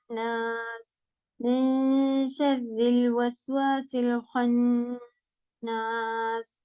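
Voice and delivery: slow, melodic Quranic recitation. Acted. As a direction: recite it slowly, drawing out the vowels.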